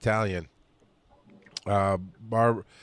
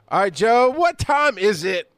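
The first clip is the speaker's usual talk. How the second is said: high, drawn-out showy cue; segment call-out; 9 semitones higher than usual. Pretend. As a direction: call it out slowly like a hype host. Host cues co-host for segment intro.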